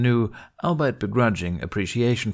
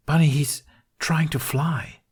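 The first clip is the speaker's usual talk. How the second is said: slow, high, awed wonder; breathy awe; 7 semitones higher, 3 dB louder, slower than usual. Acted. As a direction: let the words spill out amazed. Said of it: "words came out breathy".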